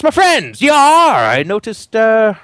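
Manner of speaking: high, loud hammed pirate captain. Captain Morgan hails the party on the boat, high and hammy.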